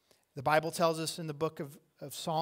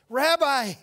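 high, loud, sudden cry; joyful recognition cry; 10 semitones higher, 12 dB louder than usual. Acted. Mary recognizes her teacher's voice, overjoyed cry. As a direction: call out on recognizing a beloved voice. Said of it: "The first thing she does".

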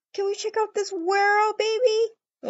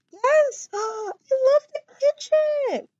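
higher and louder, gasping with joy; excited; 9 semitones higher, 5 dB louder than usual. Pretend. Girlfriend seeing the kitchen, gasping with joy. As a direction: burst out with delight.

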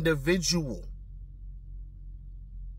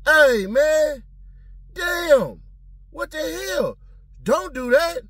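high, outraged mock protest; high-pitched imitated exasperation; high protesting defendant; 12 semitones higher, 5 dB louder, slower than usual. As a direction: throw up your hands in disbelief. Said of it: "turning around and saying".

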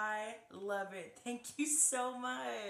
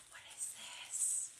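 soft, voiceless whisper; awed whisper; 9 dB quieter than usual. Real, not acted. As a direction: whisper it as a gift is revealed. Awed reaction to next gift.

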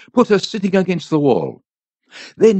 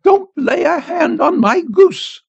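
high, slow, protective cry; woman crying out; 12 semitones higher, slower than usual. A narrator's reading. Old woman guards her goose from the priest, high cry. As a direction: warn him off in a high drawn-out voice. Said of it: "she cried".

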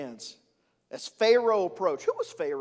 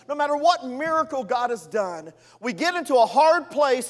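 normal talking voice

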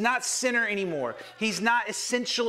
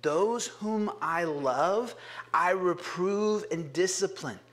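slow reading; slower than usual. Acted. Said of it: "he says".

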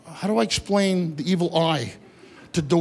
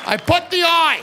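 loud punchline; 9 semitones higher, 6 dB louder than usual. Real, not acted.

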